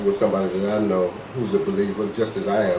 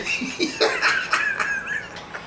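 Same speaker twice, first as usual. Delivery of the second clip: high shrieking laugh; 4 dB quieter than usual. Real, not acted. Co-host cracking up at people's outrage, shrieking laugh. Big laugh after joke.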